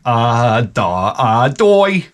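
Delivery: clueless "ah da ah doy" with a dumb rising doy; lightly hammed dopey grunts; dopey stammer. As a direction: play the blank-brained husband. Dopey noise for blank mind.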